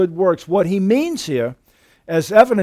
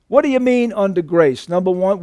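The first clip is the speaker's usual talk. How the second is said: normal talking voice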